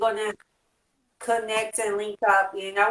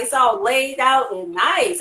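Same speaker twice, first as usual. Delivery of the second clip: usual speaking voice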